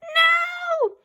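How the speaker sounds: high shouted "No!"; horrified shout. Acted. Boy shouting at the wedding. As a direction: yell it in despair.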